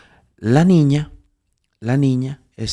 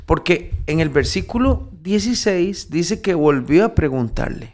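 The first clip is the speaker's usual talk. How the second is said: fervent preaching; 5 semitones higher than usual. Acted. Preaching to Andrés.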